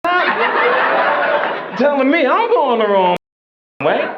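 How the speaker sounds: high indignant inner rant; mock outrage. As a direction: fume at the clerk in a squeaky voice. Inner rage at the clerk.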